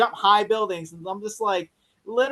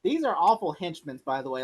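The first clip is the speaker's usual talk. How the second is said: ordinary talk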